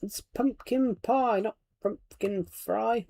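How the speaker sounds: high, slow, wide-eyed giant voice; BFG giant quote. Retold or imitated. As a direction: slowly quote the friendly giant, pitched high. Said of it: "It's such a great line".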